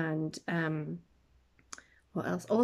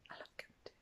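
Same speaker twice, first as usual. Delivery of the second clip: barely audible whisper; whispered aside; 23 dB quieter, much faster than usual. Real, not acted. Fan confessing love of an old comedy, whispered aside.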